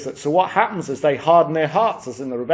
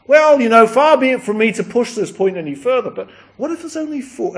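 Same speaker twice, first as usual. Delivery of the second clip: bargaining Abraham; 8 semitones higher, 5 dB louder than usual. Acted.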